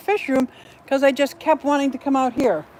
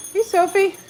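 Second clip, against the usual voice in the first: sing-song call; 11 semitones higher, 3 dB louder, much slower than usual. Real, not acted. Calling her bird in a sing-song voice.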